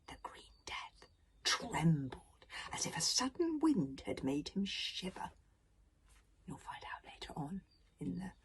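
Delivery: soft whispered storyteller aside; hushed aside. Real, not acted. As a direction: lean in and whisper the secret. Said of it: "reader whispers aside".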